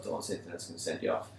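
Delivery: quick, soft self-warning under the breath; quiet fast reminder to keep his temper; muttered to self. Retold or imitated. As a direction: hurry it low, reminding yourself. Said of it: "At that point, when I was like".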